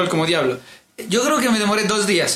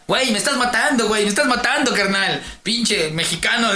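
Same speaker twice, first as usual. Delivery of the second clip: higher-pitched, sing-song Mexican complaint; mock Mexican gamer accent; 4 semitones higher than usual. Friend retelling online insults on gringo servers, mock Mexican accent. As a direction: imitate a Mexican player griping. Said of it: "decían los gringos".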